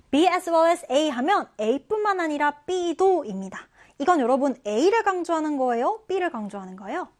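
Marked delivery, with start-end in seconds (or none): 0.1-3.6 slow, slightly higher B as well as A; slow teaching read. Shown as a demonstration. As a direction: explain it slowly to students. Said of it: "다음 표현 같이 볼게요". (천천히 설명)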